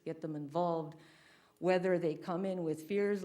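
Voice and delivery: ordinary talk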